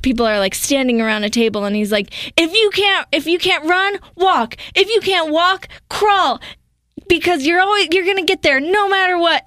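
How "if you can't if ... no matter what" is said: high-pitched mock inspirational speech; big motivational finish, hammed up; motivational speaker. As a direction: imitate a rousing end-of-speech pep talk. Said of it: "the end of his speech".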